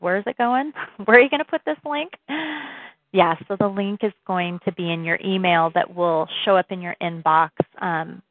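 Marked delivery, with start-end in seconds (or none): 0.0-2.1 higher, rising questions with a laugh; imagined puzzled listeners. Pretend. Listeners wondering where the link goes, playfully voiced questions. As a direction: play the listeners' question, pitch climbing quickly. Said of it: "You're probably like".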